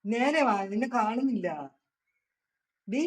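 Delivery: calling out. Real, not acted. Calling to girl on video call.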